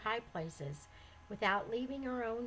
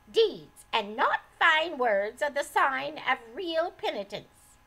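put-on loud, higher scolding old woman; surly old lady; 3 semitones higher, 7 dB louder than usual. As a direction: scold her like a bitter mother-in-law. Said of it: "in a surly manner".

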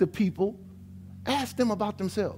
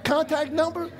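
exasperated; 8 semitones higher, 3 dB louder, slower than usual. Retold or imitated. Preacher voicing his own frustration.

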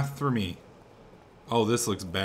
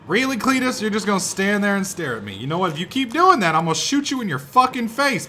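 high, loud scolding rant; exasperated threat; 7 semitones higher, 6 dB louder than usual. Pretend. Player warning Cletus to stop staring, exasperated threat. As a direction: threaten him in mock outrage.

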